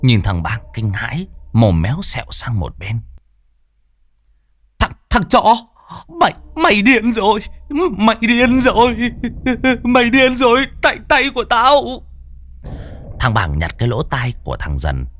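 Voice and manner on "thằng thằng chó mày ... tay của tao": high, strained cries of pain and terror; a maimed thug shrieking in disbelief; stammering high-pitched agony; agonized panic. Acted. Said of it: "đau đến thấu trời".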